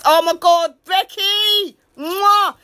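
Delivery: high, loud gushing hello; excited greeting. Retold or imitated. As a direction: gush over Becky with a kiss. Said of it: "I was".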